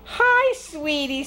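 high, cooing greeting; delighted Grandma. Acted. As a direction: open big and high, then soften. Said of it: "said Grandma".

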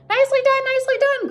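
very high goose cheer; goose crying out. A narrator's reading. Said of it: "cried the goose".

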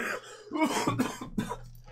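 rough hacking cough; real cough from a chest infection; chesty cough. Real, not acted. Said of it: "I've got a chest infection".

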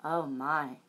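ordinary delivery, nothing marked

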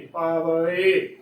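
ਪਾਵੇ slowly as "paa-va-e"; correct three-syllable reading. Shown as a demonstration. Teacher giving the correct reading, ਪਾਵੇ as slow "paa-va-e". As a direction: keep a short "va" before the separate e in ਪਾਵੇ. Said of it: "ਪਾਵੇ ਉਚਾਰਨ ਕਰਨਾ ਹੈ".

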